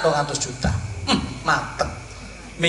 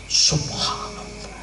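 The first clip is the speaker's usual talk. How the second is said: awed whisper; 6 dB quieter, slower than usual. Acted. Awed whisper after story.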